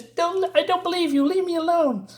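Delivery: high-pitched protesting woman's voice; distressed woman. Acted. Rose protests to the taunting voices.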